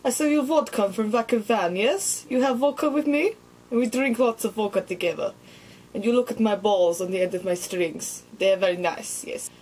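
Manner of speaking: lightly put-on Russian accent; a hint of a Russian vodka drinker. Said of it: "I look Russian".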